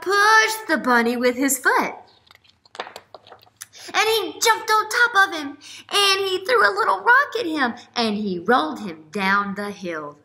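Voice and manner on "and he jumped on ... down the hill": normal talking voice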